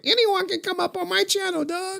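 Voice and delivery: high, mocking sing-song boast; high mocking voice of a boastful streamer; mocking impression.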